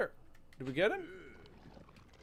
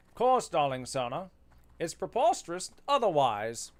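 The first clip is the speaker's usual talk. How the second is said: a hint of old-film transatlantic accent; mild posh transatlantic accent. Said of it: "transatlantic accent".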